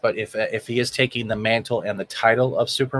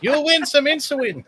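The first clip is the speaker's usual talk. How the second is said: mock voice through laughter; 12 semitones higher than usual. Joking reply to donation message.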